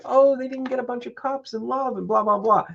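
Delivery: a lightly voiced whiny critic; mocking dismissive quote. Mocking what everybody says about Virgos. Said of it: "everybody's like".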